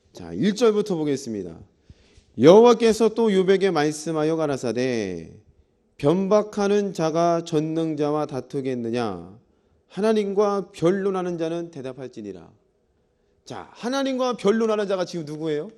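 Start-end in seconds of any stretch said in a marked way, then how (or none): 2.4-12.5 slow, loud, declamatory Bible reading; scripture reading. Acted. Pastor reads God's challenge to Job aloud, scripture reading. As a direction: proclaim the verse in drawn-out phrases.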